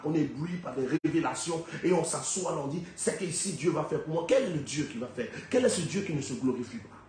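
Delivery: normal talking voice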